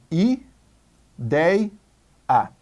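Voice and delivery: "i, dei, a" with a pause after each syllable; separated into syllables. Shown as a demonstration. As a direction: split ideia slowly into "i, dei, a". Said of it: "Vamos separar em sílabas". ("i, dei, a", sílaba por sílaba, com pausas)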